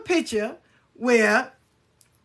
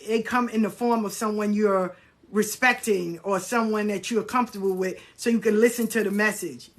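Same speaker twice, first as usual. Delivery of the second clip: normal talking voice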